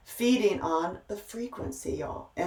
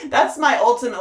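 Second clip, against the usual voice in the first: laughing; 7 semitones higher, 8 dB louder than usual. Real, not acted. Laughing at her own joke.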